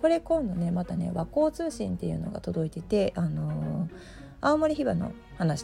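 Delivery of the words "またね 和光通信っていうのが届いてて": normal talking voice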